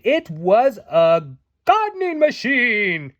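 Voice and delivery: a touch of a boastful fairy; proud announcement. A narrator's reading. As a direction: present the invention proudly. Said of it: "Tink announced proudly".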